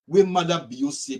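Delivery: usual speaking voice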